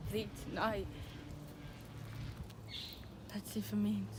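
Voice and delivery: soft, brooding lines to himself; subdued soliloquy. Acted. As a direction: think aloud softly, with heavy pauses. Romeo's soliloquy alone, resolving to die.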